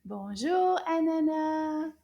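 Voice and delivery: loud, slow, cheerful call of the name; nice loud voice. Shown as a demonstration. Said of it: "Can you say that in a nice loud voice?".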